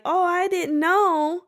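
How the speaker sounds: high, slow mock-innocent gasp; fake innocence. Imitating the girl pretending she didn't know, high and slow. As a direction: play dumb, drawn out and high. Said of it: "if she just pretended like".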